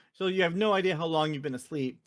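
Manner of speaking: ordinary talk, nothing marked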